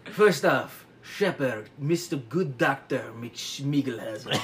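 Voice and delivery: badly faked French accent, slowing down; clumsy French detective accent; Poirot. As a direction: address him in mock French accent. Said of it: "And he's like".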